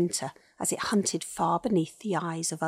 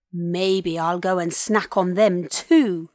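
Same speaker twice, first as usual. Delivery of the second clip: loud, slow gloating threat; wicked, deliberate taunt; chuckling villain; 8 dB louder, slower than usual. A narrator's reading. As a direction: gloat like a big hungry dinosaur. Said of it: "chuckled the big dinosaur".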